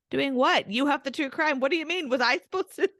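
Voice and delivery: mock panic at being caught unprepared; retold alarm. Retells own panicked reply to mom.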